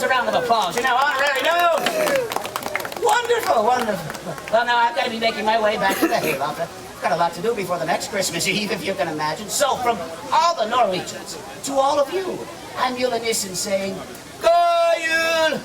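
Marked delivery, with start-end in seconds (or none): 3.0-3.6 squealed wonderful; high excited cheer. Acted. Host cheers. 14.4-15.6 bright shouted greeting; loud festive farewell. Acted. Gnome signs off.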